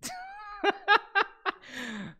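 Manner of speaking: a long high-pitched giggle; high squealing laugh. Real, not acted. Streamer laughing at a cheesy chess pickup line, high giggle.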